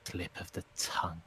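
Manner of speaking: sneering whisper. Acted. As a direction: hiss it under the breath. Creature voice drops to whisper.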